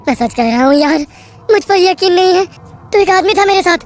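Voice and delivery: pleading. Acted. Narrator pleading.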